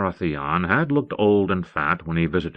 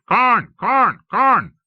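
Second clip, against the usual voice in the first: screaming raven; 7 dB louder, much slower than usual. A narrator's reading. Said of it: "Mormont's raven screamed at him".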